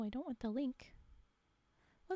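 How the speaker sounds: usual speaking voice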